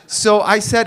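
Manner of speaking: usual speaking voice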